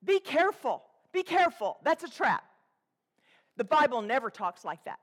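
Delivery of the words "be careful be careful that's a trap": louder, higher warning from the pulpit. Real, not acted. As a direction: raise the voice and warn them. Preacher warning the flock.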